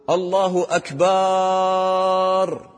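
"أكبر" stretched to "أكبااار" in a high chant; slow chant dragging out the end of "أكبر"; mistaken final lengthening. Shown as a demonstration. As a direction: hold the last vowel of "أكبر" very long. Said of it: "هذا خطأ".

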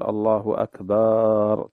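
"أكبر" with its ba drawn out long, akbaar, slow; "الله أكبر" with a lengthened second syllable in "أكبر"; stretched akbar. Shown as a demonstration. Sheikh imitating callers who stretch "أكبر" into drum. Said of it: "مد أكبر".

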